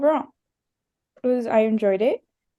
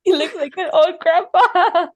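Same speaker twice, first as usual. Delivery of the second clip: high, loud, laughing through the words; laughing tease; 7 semitones higher, 5 dB louder than usual. Real, not acted. Teasing a friend about their video image, laughing hard. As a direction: crack up while teasing.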